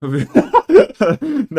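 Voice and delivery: loud high giggle; high laughing fit. Real, not acted. Laughs at his dating ideas.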